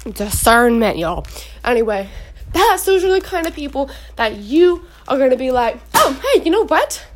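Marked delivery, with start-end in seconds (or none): 5.9-7.0 higher, lilting pretend pitch to a friend; eager approach. Believer inviting a friend along, acted eagerness. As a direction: pipe up as if it just struck you.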